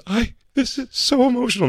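high-pitched emotional gush; high mock-tearful girlfriend voice. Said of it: "she goes".

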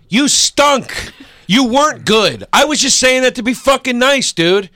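building from loud and high to a near shout; retold telling off. Telling the bombed comic off after the show, building to a yell.

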